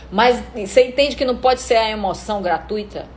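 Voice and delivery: ordinary talk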